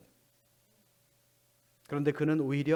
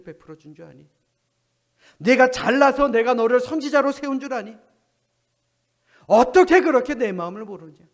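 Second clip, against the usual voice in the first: thundering rebuke; 8 dB louder than usual. Pretend. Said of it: "호통을 치셨을".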